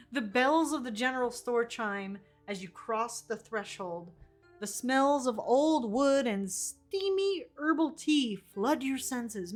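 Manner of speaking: ordinary delivery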